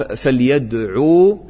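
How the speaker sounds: فليدعو with the final و stretched slowly; teacher showing the missing letter; drawn final vowel. Shown as a demonstration. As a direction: hold the end of فليدعو to show the lost و. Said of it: "شوف الوا".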